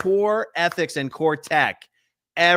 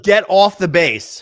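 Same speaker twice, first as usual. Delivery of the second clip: louder, slow, stern command; mock order; 2 semitones higher, 9 dB louder, slower than usual. Staff ordering her off the base, a slow, loud mock command. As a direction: bark the order to leave.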